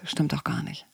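quietly contradict, as an aside; understated, soft dismissal; little inner commentator. Retold or imitated. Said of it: "Der kleine innere Kommentator".

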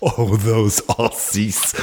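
slow, mock German accent on Aussies; a hammed-up, slightly slowed German tourist accent. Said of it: "in my best German accent".

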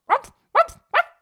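very high, loud barks; barking. A narrator's reading. Scheetje barking at the bushes, high loud barks. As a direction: bark like a little dog. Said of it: "hard geblaf".

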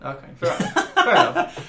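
giving in through laughter; laughing. Real, not acted. Laughing concession.

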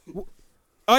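ordinary talk

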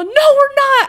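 shrieking denial at the comforting friend; whiny yell. Retold or imitated. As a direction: yell it back like a drunk, upset kid. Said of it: "And I was all".

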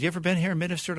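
high, eager question; quoted guy's question. Retold or imitated. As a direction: ask brightly like a stranger recognizing him. Guy asking at wedding.

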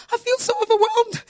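high, loud imitation of an overwhelmed woman; overwhelmed colleague. Preacher imitating her colleague overcome at the office, high and loud. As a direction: mimic her near-tearful rush of feeling. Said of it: "she's also".

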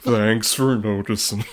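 slow, glum donkey impression; Eeyore. Co-host answering as the gloomy donkey, slow mock dejection. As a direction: plod through it with mock gloom. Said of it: "I become Eeyore".

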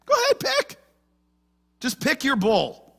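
high, daring voice egging them on; playful taunting challenge; Elijah's challenge. Retold or imitated. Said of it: "He says".

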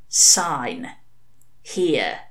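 Sign, long dead pause, then Here; terrible pause. A narrator's reading. Hastur making Crowley sign, a terrible pause between words. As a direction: leave a menacing gap between Sign and Here. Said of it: "leaving a terrible pause between the words".

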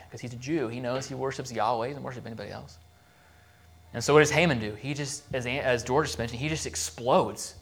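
ordinary delivery

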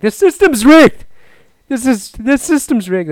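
high, loud indignant complaint; hammed-up sore loser; mock whining. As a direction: whine that the game is cheating you. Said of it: "And then I started whining about it".

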